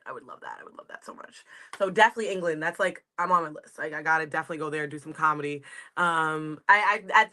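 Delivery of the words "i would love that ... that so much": whispered longing. Real, not acted. Dreamy wish about doing comedy.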